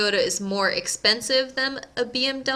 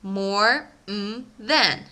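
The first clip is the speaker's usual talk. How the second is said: slow pattern demo; much slower than usual. Shown as a demonstration. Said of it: "you need to say".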